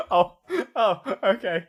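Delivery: high, loud, laughing "oh"s; mock flustered acknowledgement; imitated awkward surprise. Son reacting to his dad about the note, mock awkward surprise.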